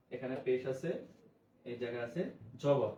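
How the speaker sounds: usual speaking voice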